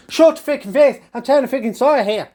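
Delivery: high, loud snapping rant; a high, fast angry yell; mock angry granny. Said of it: "He goes".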